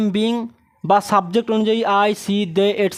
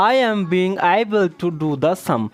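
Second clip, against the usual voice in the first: slow dictation; 3 semitones lower, much slower than usual. Shown as a demonstration. Teacher dictating the answer slowly.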